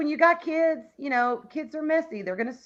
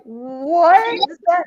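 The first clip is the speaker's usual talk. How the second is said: slow, loud, drawn-out retold disbelief; exaggerated incredulous question; mock bafflement; 3 semitones higher, 7 dB louder, much slower than usual. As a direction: imitate a baffled crowd, stretching it loudly. Retells others' baffled reaction.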